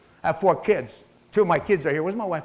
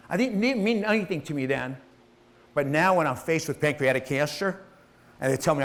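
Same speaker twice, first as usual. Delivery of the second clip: ordinary talk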